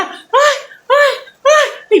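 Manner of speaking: high-pitched imitated cries of "ay"; mock pained wail. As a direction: wail like someone just punched, playing it for laughs. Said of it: "la malandra lo que hacía era".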